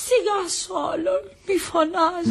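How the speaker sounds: high, feeble, whimpering plea; slow, high fake-invalid whine; feigned heart attack. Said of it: "Έχω ανάγκη από μια καρδιακή κρίση".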